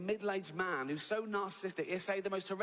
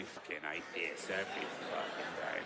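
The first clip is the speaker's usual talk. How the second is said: low, soft, weary devil voice; bored devil; 10 semitones lower, 3 dB quieter than usual. Retold or imitated. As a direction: drone it low and fed up, every single day. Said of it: "He's probably bored, isn't he?".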